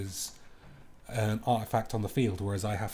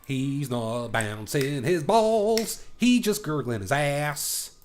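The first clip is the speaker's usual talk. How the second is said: silly taunt voice; 4 semitones higher, 5 dB louder, much slower than usual. Pretend. Silly taunt about opponent.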